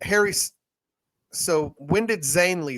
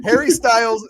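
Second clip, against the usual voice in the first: excited naming; 7 semitones higher, 7 dB louder than usual. Real, not acted. Excited listing of band.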